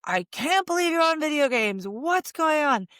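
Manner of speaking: a retold exasperated scold at the kid; a touch of angry disbelief; mock scolding. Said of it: "instead of being like".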